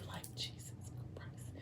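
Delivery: barely audible, voiceless whisper; whispered aside. Real, not acted. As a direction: whisper it under your breath to yourself. Aside reacting to the story.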